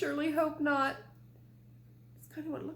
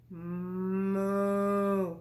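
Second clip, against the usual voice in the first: low drawn-out moo; puppy mooing like a cow; mock moo; 5 semitones lower than usual. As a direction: stretch it into a cow's moo. Dog moos.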